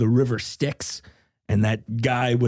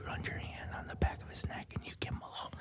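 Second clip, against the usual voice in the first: whisper; 19 dB quieter than usual. Acted. Whispered intimate bit.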